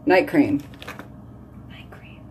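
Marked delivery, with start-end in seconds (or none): 1.7-2.2 barely-there voiceless whisper. Real, not acted. Telling viewers what she is applying, whispered. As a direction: drop to a breath of a whisper.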